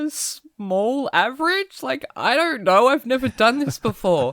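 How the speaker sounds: high, squeaky panicked stammer; flustered, voice jumping way up; mortified. Retold or imitated. Embarrassed guy answering the clerk, high mortified squeak.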